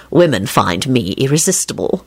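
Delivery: low, smug-cheerful boast; relaxed, deep-pitched good cheer. A narrator's reading. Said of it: "Lupin told Quentin cheerfully".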